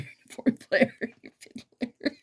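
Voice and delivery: high-pitched, laughing through the words; real laughter breaking into speech. Real, not acted. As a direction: crack up while listing them. Laughs while piling on layers.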